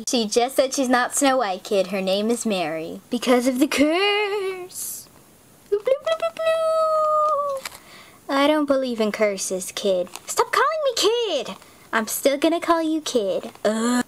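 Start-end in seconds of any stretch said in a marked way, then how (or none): 5.7-8.3 squeaky high sing-song; high warble. Acted. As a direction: wobble it like a spell. Cartoonish curse sound effect.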